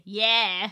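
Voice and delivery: YEAR said as "Yeah"; mimicked pronunciation of year. Teasing a friend who can't say ear, year said as "Yeah". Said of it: "how do you say year".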